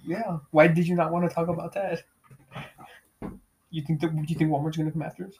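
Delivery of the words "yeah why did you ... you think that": normal talking voice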